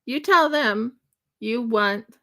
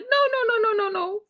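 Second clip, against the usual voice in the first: high-pitched string of mock-frantic no's; retold panicky no-no-no; frantic refusal; 13 semitones higher, faster than usual. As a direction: protest in a high, rapid-fire chain. Retells frantic refusal to mom.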